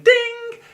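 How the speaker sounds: high, loud sung ding; ping it out like a call bell; imitated chime. Passengers pressing the assistance button, imitated high ding.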